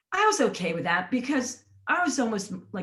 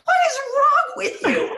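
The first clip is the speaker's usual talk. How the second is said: high, loud, exasperated shout; shrill furious disbelief; outraged yell; 7 dB louder than usual. Retold or imitated. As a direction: shout at him after being pulled up. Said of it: "I'm like".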